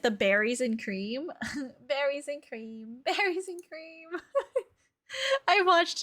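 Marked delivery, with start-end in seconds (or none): 1.9-4.1 soft, giggly sing-song imitation; playful mimicry. Streamer mimicking a funny clip a viewer sent, playful and giggly. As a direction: mimic a silly catchphrase through laughter.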